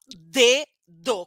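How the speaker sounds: dedo with a short popping dental d; teacher demo of a plosive d. Shown as a demonstration. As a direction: burst the d in Dedo, short and voiced. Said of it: "Também é plosivo".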